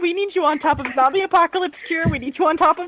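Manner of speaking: high, rushed mock scolding; mock-urgent demand. Player leaning on Jojo over zombie cure and tardis tech, mock-urgent demand. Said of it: "I can't keep a straight face".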